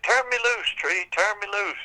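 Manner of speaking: desperate witch. Acted. Witch hanging by her hair begs the tree to let go. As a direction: screech to be let go. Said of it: "she said".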